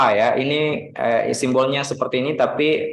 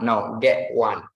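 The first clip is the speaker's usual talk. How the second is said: normal talking voice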